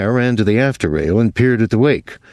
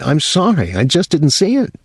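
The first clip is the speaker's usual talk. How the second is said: near tears; 8 semitones higher than usual. Acted. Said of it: "Wesley was near tears".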